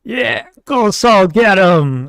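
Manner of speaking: high, slow, loud cheer; drawn-out, high battle cheer; cheering yell. Acted. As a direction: yell encouragement to a fighting comrade. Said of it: "He'll yell".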